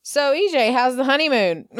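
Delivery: lightly hammed-up, high-pitched taunt; mock teasing. Retelling Rafe's jab at E J in jail, mock teasing. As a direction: pitch it up like a playful needle. Rafe taunts jailed E J, retold.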